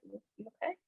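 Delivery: soft, quiet concern; hushed caring question; gentle check-in. Retold or imitated. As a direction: ask softly if they're okay. Friend quietly checking on her.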